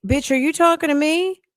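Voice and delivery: high-pitched mock challenge, rising at the end; acted confrontational retort. Imagining some people answering her back, mock confrontational. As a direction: throw it back as an indignant question. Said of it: "Some people would just be like".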